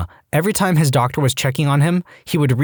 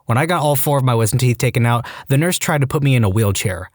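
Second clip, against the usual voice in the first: plain reading voice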